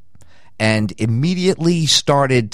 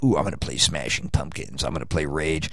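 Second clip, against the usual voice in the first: much softer, lower eager mutter; mock excited hush; 7 semitones lower, 8 dB quieter than usual. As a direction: murmur it to yourself with glee. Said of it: "you're all like".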